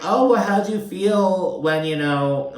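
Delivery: high, slow mock jab at Julia; lightly voiced sly question; catty jab. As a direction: needle her like a sly frenemy. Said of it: "she said, like".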